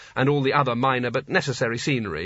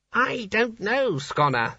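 high, slow, timid answer dropping away; meek reply; 9 semitones higher, much slower than usual. A narrator's reading. Small wizard answering the angry Sconner, meekly. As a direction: answer meekly, afraid to contradict. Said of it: "said the wizard meekly".

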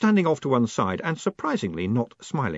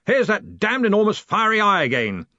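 high, booming complaint; bellowing; 10 semitones higher, 5 dB louder than usual. A narrator's reading. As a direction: keep bellowing in exasperation. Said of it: "he bellowed".

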